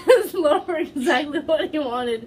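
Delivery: laughing. Real, not acted. Laughing while reading smut aloud.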